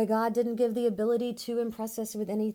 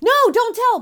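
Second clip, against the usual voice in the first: much higher, louder pleading shout; desperate yell; 18 dB louder than usual. Retold or imitated. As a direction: beg her loudly to stop. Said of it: "And I'm like".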